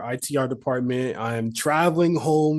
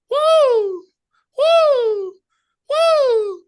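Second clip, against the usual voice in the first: string of high, loud whoops; repeated war cry; 8 dB louder, much slower than usual. Retold or imitated. Colleague mimics students whooping at the bus stop, high and loud. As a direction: whoop over and over, long and high. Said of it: "just all going".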